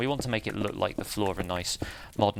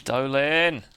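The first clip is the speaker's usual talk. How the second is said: exasperated call; 5 semitones higher, 6 dB louder than usual. Real, not acted. Finds lava near the health inspector.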